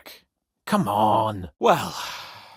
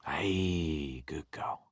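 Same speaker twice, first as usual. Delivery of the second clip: soft unvoiced praise; soothing whisper; 11 semitones lower, 11 dB quieter, much slower than usual. Real, not acted. Calming a frightened horse with whispered praise. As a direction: whisper it to calm the animal.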